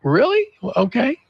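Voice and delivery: high, rising mock surprise; retold puzzled acceptance; taken aback. Retold taken-aback reply to cashier.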